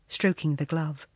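ordinary delivery

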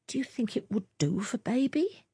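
hushed question. A narrator's reading. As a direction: whisper it so the neighbour won't hear. Husband asks quietly, voice overheard.

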